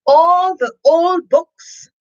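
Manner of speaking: slow, high and loud; grand. A narrator's reading. Reader building to the story's finale. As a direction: draw it out grandly.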